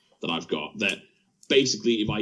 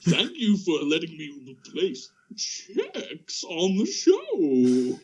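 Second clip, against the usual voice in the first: higher, slow, suave greeting; put-on new co-host voice; 7 semitones higher, much slower than usual. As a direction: greet him like a smooth stranger taking over the show. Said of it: "let's hear".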